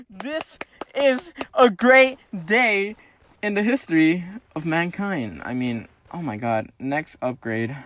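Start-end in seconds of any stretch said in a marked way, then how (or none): 0.1-5.4 mock-grand proclamation.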